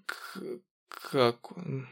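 quiet, hoarse forced-out croak. Acted. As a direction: squeeze it out in a weak rasp. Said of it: "тихо выдавил я ... в этом жалком хрипе".

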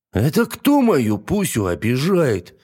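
slow, bossy big lady; a hint of a bossy big girl; stern princess. A narrator's reading. Princess bursts out of tower.

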